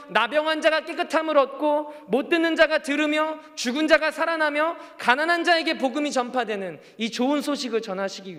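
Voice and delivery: fervent preacher in full flow; impassioned preaching. Real, not acted. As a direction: proclaim the good news to a congregation, pitched high. Preacher builds to a climax.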